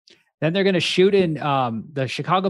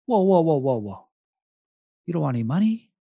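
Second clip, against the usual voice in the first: incredulous mafia guy; 6 semitones higher than usual. Retold or imitated. Mafia guy baffled by refusal.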